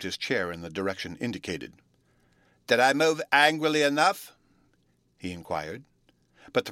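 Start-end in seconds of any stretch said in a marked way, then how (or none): 2.7-4.3 Bruno's voice. A narrator's reading. Bruno voiced asking eagerly.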